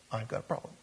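quiet aside. Shown as a demonstration. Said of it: "let's go over this again".

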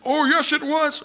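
high, insistent retort; accuser. Retold or imitated. The accuser pushing back in a high mocking retort. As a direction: needle them with a squeaky comeback. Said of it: "accuser insists".

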